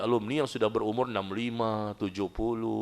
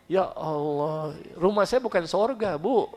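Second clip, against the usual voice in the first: exasperated sigh; 6 semitones higher, slower than usual. Pretend. Exasperated reply to gossip.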